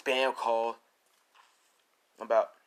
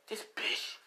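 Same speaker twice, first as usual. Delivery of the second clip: whispered in disbelief; acted stunned whisper; 4 dB quieter than usual. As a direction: whisper it to yourself, appalled. Izuku discovers cameras in house.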